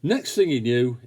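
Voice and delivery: ordinary delivery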